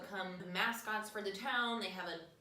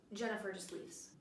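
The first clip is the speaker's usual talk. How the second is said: ordinary talk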